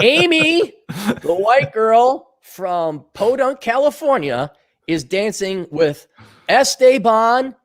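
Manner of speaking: Esteban dragged out with a slow rise; slow, high mocking read of Esteban; mock gringo drawl. Shown as a demonstration. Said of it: "Make the sentence pronounced correctly".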